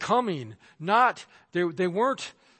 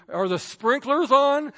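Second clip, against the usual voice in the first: mock nagging question, higher and louder; put-on raised voice for a nagging question; nagging wife; 9 semitones higher, 6 dB louder than usual. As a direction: imitate a wife pestering her husband, pitched up. Wife nagging him, retold.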